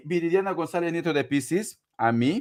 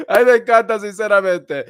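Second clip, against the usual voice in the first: delighted; 3 semitones higher, 8 dB louder than usual. Real, not acted. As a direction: gush over the comment. Delighted reaction to a comment.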